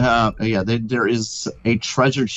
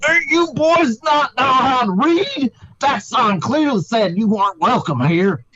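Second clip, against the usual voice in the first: high, slow booming sky voice; magically amplified voice; 12 semitones higher than usual. Acted. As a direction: scream down from the heavens. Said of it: "a booming voice from high in the sky screamed down".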